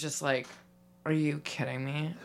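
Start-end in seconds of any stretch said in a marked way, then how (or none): 1.1-2.1 low, soft, casual incredulity; barely react, totally laid-back; relaxed disbelief. Acted. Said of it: "physically, I was really relaxed".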